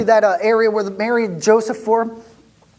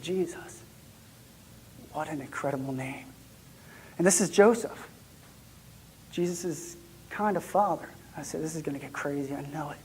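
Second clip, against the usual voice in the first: hushed; 5 semitones lower, 12 dB quieter than usual. Retold or imitated. Hushed talk in the stable.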